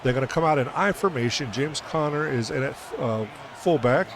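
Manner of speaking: ordinary talk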